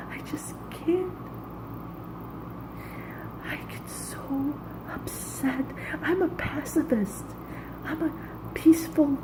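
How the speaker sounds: a soft, broken, near-tears plea, barely voiced; real choked whispered plea; tearful whisper. Real, not acted. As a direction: beg it quietly as if about to cry. Pleading, breaking down in rant.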